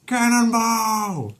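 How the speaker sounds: high, loud, stretched-out cannonball shout; cannonball yell. Acted. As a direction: belt it out as you leap. Peter jumps into pool.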